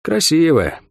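understated sadness on a falling tone; sad. A narrator's reading. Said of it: "сказал с печалью".

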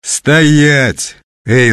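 high, loud, drawn-out order; acted commanding shout. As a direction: halt a brawl from down the corridor.

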